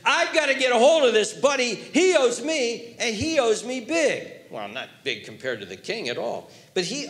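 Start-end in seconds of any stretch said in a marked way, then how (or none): 0.0-4.3 eager scheming servant. Acted.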